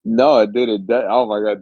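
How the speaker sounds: normal talking voice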